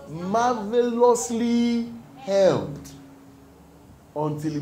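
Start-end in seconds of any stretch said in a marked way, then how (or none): 0.1-2.9 call-and-response drawl; drawn-out answer. Real, not acted. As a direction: stretch marvelously out slowly and high. Preacher draws out the answer.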